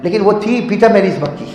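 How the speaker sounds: ordinary talk, nothing marked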